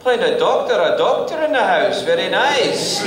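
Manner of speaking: high, loud, slow Scottish marvelling; amazed Scottish dad. Retold or imitated. Father amazed his son plays a doctor, thrilled Scottish voice. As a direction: savour every word, thrilled.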